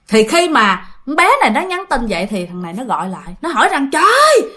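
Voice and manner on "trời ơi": high, loud exasperated cry; scolding outburst. Retold or imitated. Boyfriend phones back after her text, scolding outburst. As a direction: burst out as an angry boyfriend. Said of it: "Mà nó chửi nha".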